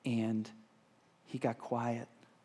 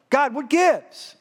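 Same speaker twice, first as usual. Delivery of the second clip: loud, high, drawn-out protest; acted exasperated outcry; indignant complaint; 13 semitones higher, 12 dB louder, much slower than usual. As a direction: demand an explanation from God. Drowned man complains to God.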